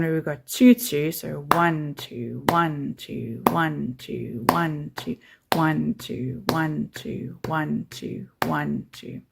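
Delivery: counting one two, leaning on one; one two, one two with one always stronger; duple count, stress on one. Shown as a demonstration. Teacher counting two beats, stressing one. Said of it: "we are going to emphasize these downbeats".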